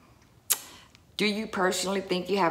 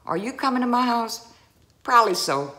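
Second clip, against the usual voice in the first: mock exchange; 7 semitones higher, 5 dB louder, faster than usual. Shown as a demonstration. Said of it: "That's how we do".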